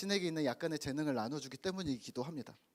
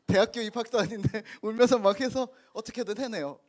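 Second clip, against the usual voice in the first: raised, louder amused telling; louder, higher delighted retelling; excited telling; 5 semitones higher, 8 dB louder than usual. Real, not acted. Amused telling of crying child.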